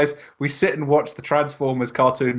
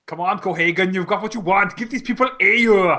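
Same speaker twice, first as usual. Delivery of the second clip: mock Arnold line; 5 semitones higher than usual. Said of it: "has he done".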